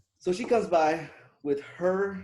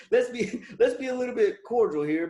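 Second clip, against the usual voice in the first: laughing through it; 9 semitones higher, 6 dB louder than usual. Retold or imitated. Laughing mock neighborly politeness.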